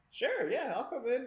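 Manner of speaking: high-pitched, cheery acceptance; agreeable, pitched well up; eager agreement. Retold or imitated. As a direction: pipe up with a willing yes. Acting out own eager phone reply.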